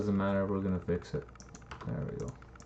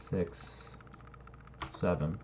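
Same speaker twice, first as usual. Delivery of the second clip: normal talking voice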